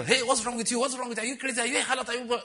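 mock scolding, high-pitched rapid-fire questions; finto rimprovero indignato. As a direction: imitate someone telling a girl off in a high voice. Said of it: "Is it for to tell that girl".

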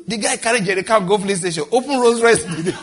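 words bubbling through real laughter; can't hold back the giggles; laughing while telling. Real, not acted. Preacher retelling the Rolls Royce fuel story, laughing.

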